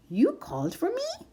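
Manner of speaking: very high, curious mouse voice; tiny mouse asking brightly; little mouse. A narrator's reading.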